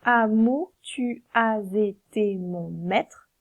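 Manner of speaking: slow, syllables apart; slow scansion. Shown as a demonstration. Teacher scans the octosyllable, slowly. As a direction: count each syllable out slowly. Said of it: "le E est de toute façon muet".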